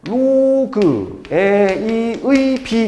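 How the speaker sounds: "로그 A의 B" drawn out very slowly, syllable by syllable; slow sound reading. Shown as a demonstration. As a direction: spell out "로그 A의 B" slowly as pure sounds. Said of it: "소리로 읽으면". ("로그 A의 B"를 아주 천천히 소리로 읽기)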